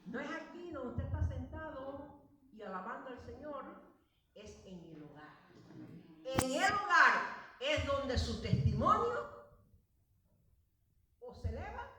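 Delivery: fiery sermon delivery; preaching at full force. Acted. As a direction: drive it home from the pulpit.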